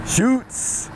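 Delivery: the local way to say "shoots", pitched high; Pidgin shoots. Shown as a demonstration. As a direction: lift "shoots" up high and let it ride up. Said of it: "You gotta say it like that".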